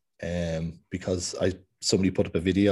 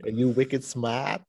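slow, higher-pitched mock Boston accent; 4 semitones higher, much slower than usual. Quoting Boston films to co-host in slow Boston accent. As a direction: stretch out the Boston vowels. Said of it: "it's just full of that accent".

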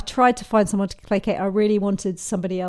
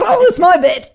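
high, loud mock surprise; suddenly remember it's your turn, playfully; flustered realisation; 9 semitones higher, 9 dB louder than usual. Writer-actor realising it is her line, mock flustered. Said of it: "stop in the middle and go".

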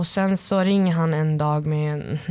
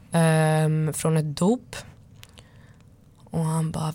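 normal talking voice

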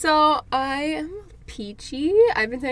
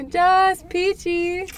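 drawn-out peachy; 6 semitones higher, much slower than usual. Real, not acted. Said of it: "peachy is my answer".

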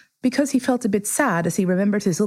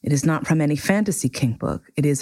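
usual speaking voice

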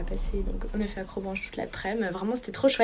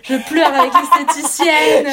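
high, loud, slow laughing wail; 6 semitones higher, 13 dB louder, slower than usual. Real, not acted. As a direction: laugh through the words. Laughing while telling tears.

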